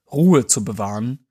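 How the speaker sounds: ordinary delivery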